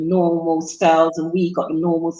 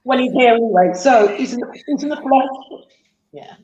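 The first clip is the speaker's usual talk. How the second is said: tearful; 4 semitones higher than usual. Real, not acted. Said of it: "Take your time".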